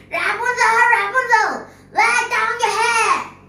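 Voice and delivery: high, loud call up the tower. Acted. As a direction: call out to the window above. Witch calls up the tower.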